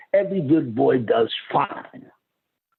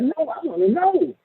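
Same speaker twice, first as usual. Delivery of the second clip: mock protest; 12 semitones higher than usual. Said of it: "I'm like".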